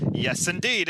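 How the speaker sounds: normal talking voice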